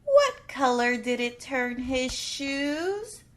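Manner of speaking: ordinary delivery, nothing marked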